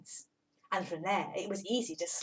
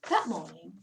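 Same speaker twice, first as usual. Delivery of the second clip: usual speaking voice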